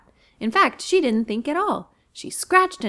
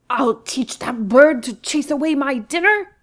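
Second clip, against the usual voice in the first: higher, louder angry lion; spluttering lion; 4 semitones higher, 7 dB louder than usual. Acted. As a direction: spit the threat out. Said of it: "he spluttered".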